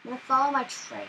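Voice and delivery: usual speaking voice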